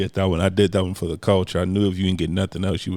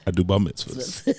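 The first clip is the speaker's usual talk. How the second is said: ordinary delivery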